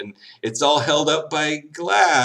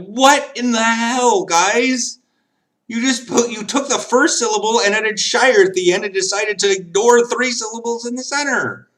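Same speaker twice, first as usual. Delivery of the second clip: higher, louder exasperated rant at the spelling; 11 semitones higher, 4 dB louder than usual. Retold or imitated. A tourist ranting at English place names, exasperated. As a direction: throw up your hands at the silly spelling. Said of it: "And I'm like".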